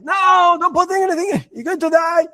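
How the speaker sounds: high frantic shout of warning; father's scream. Retold or imitated. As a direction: yell it like a panicked father stopping his boy. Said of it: "and he screams".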